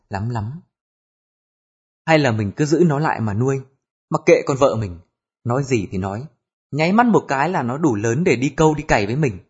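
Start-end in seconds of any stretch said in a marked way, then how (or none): none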